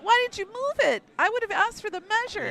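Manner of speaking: high, fast disbelieving protest; outraged that the rock moved; exasperated. Real, not acted. Rocks moved before measuring.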